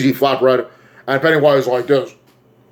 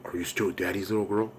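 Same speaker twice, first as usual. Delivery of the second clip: hushed creepy father, much softer; creepy dad; 4 semitones lower, 14 dB quieter than usual. Acted. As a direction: murmur it low and soft, like a sinister dad. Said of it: "the face of her dad's like".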